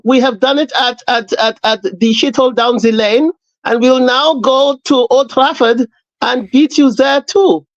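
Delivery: mock German accent, preacher-like; hammed-up German orator hailing the father. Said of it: "Why the German accent".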